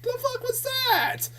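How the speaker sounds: very high, drawn-out disbelief; incredulous outburst. Acted. Reviewer voicing a baffled viewer after the orgasm scene, incredulous. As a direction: squeal it slowly in mock shock. Repeats stunned viewer reaction.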